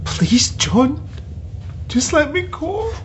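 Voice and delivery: desperate plea. Acted. Captive begs John to let her go, rising desperate plea. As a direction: beg him, voice rising in panic.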